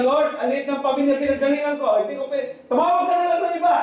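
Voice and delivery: panicked refusal, begging the Lord to pick someone else; pleading Gideon. Acted. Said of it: "Ang sabi niya". (nagmamakaawang sigaw ni Gideon)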